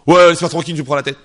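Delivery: loud, fed-up brush-off; irritated retort. Acted. Friend rejecting his friend's warning, loud and irritated. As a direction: push the friend away, annoyed and loud.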